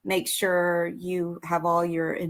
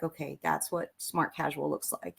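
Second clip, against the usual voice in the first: quiet note to self; 3 semitones lower, 9 dB quieter than usual. Retold or imitated. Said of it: "I'd be like".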